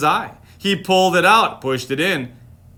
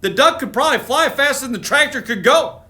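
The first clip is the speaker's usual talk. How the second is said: excited; 8 semitones higher, faster than usual. Real, not acted. Excited intro about the duck.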